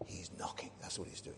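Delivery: hushed unvoiced whisper; preacher whispering the answer back. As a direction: answer in a near-silent whisper. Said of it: "What's he doing?".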